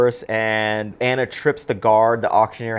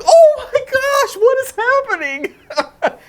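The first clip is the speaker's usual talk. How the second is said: put-on amazed kid; 6 dB louder than usual. Childhood self watching the film, high squealing amazement. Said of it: "I remember watching this as a kid going".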